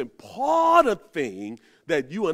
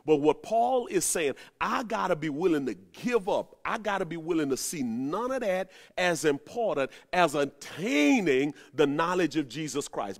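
ordinary delivery

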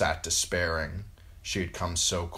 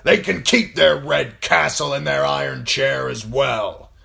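loud, higher-pitched scornful declaration; emphatic, defiant lord; resolute lord; 8 semitones higher, 10 dB louder than usual. Acted. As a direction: dismiss them forcefully before the hall. Lord Karstark continues in story.

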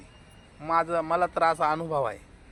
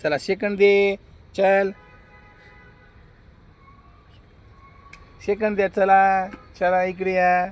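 calling a command; 3 semitones higher, 6 dB louder than usual. Real, not acted. Commanding goat to shake hands.

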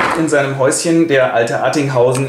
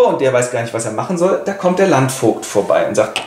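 normal talking voice

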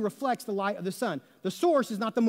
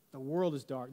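lower, softer repeat of "The world is dark"; soft echo; 7 semitones lower, 7 dB quieter than usual. Shown as a demonstration. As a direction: let it drop, quiet and low.

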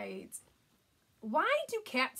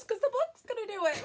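usual speaking voice

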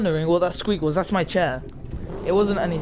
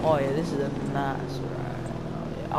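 low put-on voice; 5 semitones lower, 6 dB quieter than usual. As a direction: croon it low like a smooth operator. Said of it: "why I'm speaking like that".

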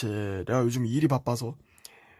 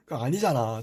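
normal talking voice

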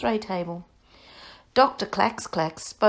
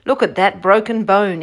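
a touch of excitement, louder; exclaiming; 9 dB louder than usual. Acted. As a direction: point it out eagerly. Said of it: "exclaimed Doctor Clax Clax".

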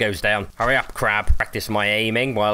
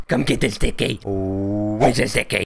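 put-on baby-talk coax. Luring the shark again, cutesy baby-talk coax. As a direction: back near normal pitch, cutesy baby word.

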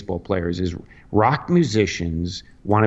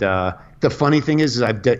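usual speaking voice

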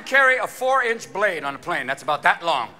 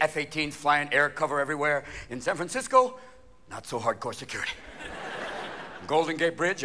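ordinary talk

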